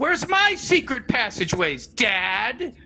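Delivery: put-on high, slow kid voice asking dad; mock child whining at his father; childish plea. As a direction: play the little boy begging, high and drawn out. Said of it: "I grew up and I'm like".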